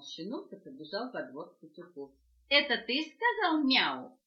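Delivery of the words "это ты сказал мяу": loud, slow curious question; puppy asks the rooster loudly, slowly; puppy's question. Acted. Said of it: "спросил щенка петух".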